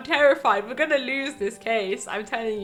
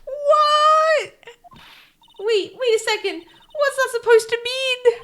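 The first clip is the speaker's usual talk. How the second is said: very high, loud shriek of disbelief; shocked outcry; 14 semitones higher, 7 dB louder than usual. Acted. Maya hearing from Nick that he's gone, shocked. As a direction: squeal it in alarm, much higher.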